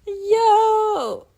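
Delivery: high, loud laughing yo. Real, not acted. Recovering from laughing at grandma. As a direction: cry out still laughing.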